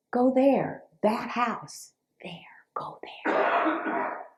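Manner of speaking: hushed, breathy urging; quiet voice in her head; whispered inner voice. Retold or imitated. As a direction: whisper the nudge softly.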